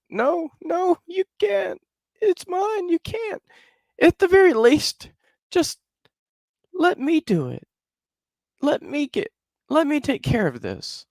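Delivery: very high put-on woman's plea; wife begging the drunk husband, high falsetto plea; pleading wife. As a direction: beg him in a squeaky falsetto. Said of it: "And she's like".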